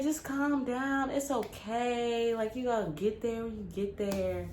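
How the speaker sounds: slow, soft calm down, reassuring; calm soothing auntie. Retold or imitated. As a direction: soothe a panicked niece slowly. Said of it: "she's just like".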